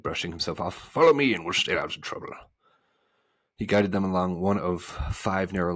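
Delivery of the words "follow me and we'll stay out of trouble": louder, put-on voice for the bug; humbug character voice. A narrator's reading. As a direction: take charge as the humbug. Bug character takes the lead.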